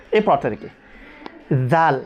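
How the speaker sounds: normal talking voice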